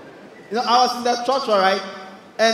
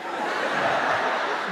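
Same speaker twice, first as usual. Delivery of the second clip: sharp gasp; 8 dB quieter than usual. Retold or imitated. Holding breath after sneeze.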